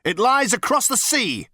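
high, loud, portentous report; significant. A narrator's reading. Number Two reporting to captain, significant. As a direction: make it sound momentous. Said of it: "narrowing his eyes significantly".

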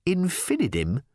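high, rising disbelief; scoffing question; incredulous echo. Acted. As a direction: query the name as if absurd. Ford scoffs at the name.

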